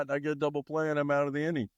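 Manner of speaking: ordinary delivery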